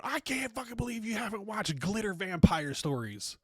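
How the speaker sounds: high, squeaky mock disbelief; shrieking high mock of an offended fan; mock outraged fan. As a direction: parody a shocked Twilight superfan. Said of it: "and you just go".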